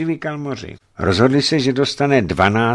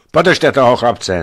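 loud, slower, raised call; 4 semitones higher, 8 dB louder, slower than usual. A narrator's reading. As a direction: call an order to someone nearby. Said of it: "zavolal na kohosi". (hlasité zvolání rozkazu)

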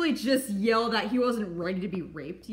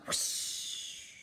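whispered whoosh; 11 dB quieter than usual. Acted. Mouth noise of running off.

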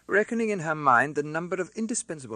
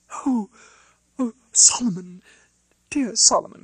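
much higher and louder, breathless with shock; a sister spotting her long-lost brother; high, ringing cry of joy; overjoyed cry; 11 semitones higher, 10 dB louder than usual. A narrator's reading. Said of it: "cried Miss Pross".

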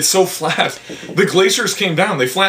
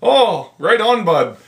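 put-on higher-pitched caricature Canadian accent; 5 semitones higher than usual. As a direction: lay on a hokey Canadian accent. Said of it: "the stereotypical Canadian accent".

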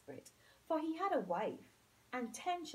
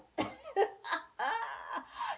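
belly laugh; 7 semitones higher, 4 dB louder than usual. A narrator's reading. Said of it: "This always made him laugh".

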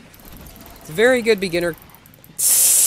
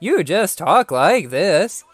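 slow deliberate demo; talking like this; much slower than usual. Shown as a demonstration. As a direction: say it slowly as a show of the trick. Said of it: "That's how you do it".

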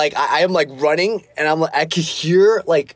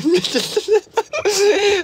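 real laugh, very high; high giggling laugh. Real, not acted. Recovering before reading chat, high giggling laugh.

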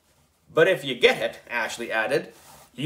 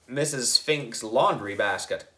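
plain reading voice

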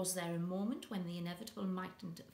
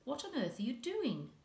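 amused; 6 semitones higher, 4 dB louder than usual. A narrator's reading.

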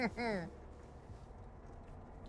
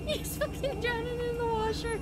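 high, panicky wail; squealed dismay at a mishap; distressed squeal; 13 semitones higher than usual. Real, not acted. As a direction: cry out in high-pitched alarm. Alarm at toy drowning in washer.

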